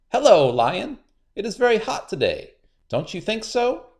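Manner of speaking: sly spider, high and sweet; a crafty trickster sounding friendly; high, cunning spider; high Anansi voice. A narrator's reading. Anansi character voice.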